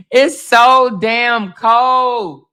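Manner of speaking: loud, drawn-out complaint; shouted slow complaint about the cold; slow exclaim. Real, not acted.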